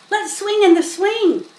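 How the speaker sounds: put-on high, loud excited boy; eager boy. A narrator's reading. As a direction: urge everyone to swing. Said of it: "said Avery".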